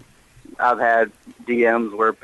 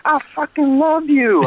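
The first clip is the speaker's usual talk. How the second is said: high, slow mock gushing "I fucking love you"; gushing fan. Retelling a Walmart fan who grabbed him, high mock gush. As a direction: imitate a big fan lady gushing, much higher.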